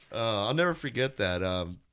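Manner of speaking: usual speaking voice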